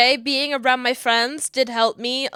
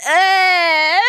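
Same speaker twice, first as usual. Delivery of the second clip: high loud sob breaking into laughter; 7 semitones higher, 5 dB louder than usual. Real, not acted. Sentimental vlogger sobbing then laughing. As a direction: cry then laugh at yourself. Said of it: "I'm a big sap".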